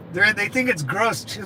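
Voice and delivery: ordinary delivery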